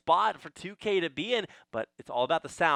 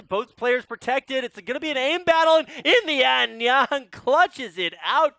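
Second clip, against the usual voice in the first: louder, higher caster hype; excited play-by-play; 8 semitones higher, 6 dB louder than usual. Real, not acted. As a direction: build to the clutch. Final duel called live.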